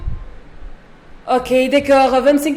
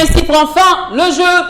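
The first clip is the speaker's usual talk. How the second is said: announcer; 3 semitones higher than usual. Acted. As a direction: proclaim the end of the game. Closing the game like an announcer.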